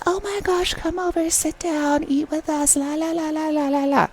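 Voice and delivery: high singsong imitation of gushing fans; chirpy high voice dissolving into la-la babble; mock fangirling. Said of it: "everybody is fangirling".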